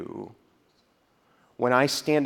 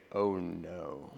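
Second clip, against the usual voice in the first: soft, low "Oh no" of alarm; quiet dismay; 7 semitones lower, 10 dB quieter than usual. Retold or imitated. Paul hearing news from Rome, a soft low dismay. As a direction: breathe it out like bad news sinking in. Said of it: "It's making him say".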